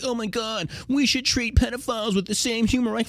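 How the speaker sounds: high, whiny mock outrage. Mocking soft outraged people.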